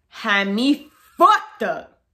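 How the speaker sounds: slow, drawn-out offended disbelief. Real, not acted. Vlogger reacting to a rude assumption, slow and offended. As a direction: let the outrage stretch out.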